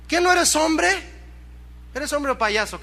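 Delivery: high-pitched mocking challenge; scolding taunt. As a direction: taunt him as you scold. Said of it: "hasta regañado salió". (regaño burlón con voz aguda)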